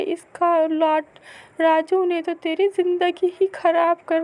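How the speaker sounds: high, loud soothing mother; consoling mother. Acted. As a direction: comfort the crying daughter. Mother comforts crying daughter. (रोती बेटी को ऊँची आवाज़ में दिलासा देती माँ)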